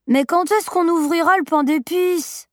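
put-on high, insistent little-boy question; petit garçon. A narrator's reading. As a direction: pipe up like an eager child. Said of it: "demanda-t-il". (voix aiguë de petit garçon)

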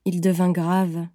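ordinary delivery

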